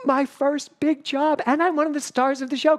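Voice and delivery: breathless-with-joy reenactment, high voice; excited young self. Retold or imitated. Voicing her excited younger self.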